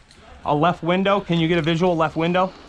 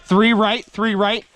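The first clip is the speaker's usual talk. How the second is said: shouting callouts; 8 semitones higher, slower than usual. Real, not acted. Calling commands and positions.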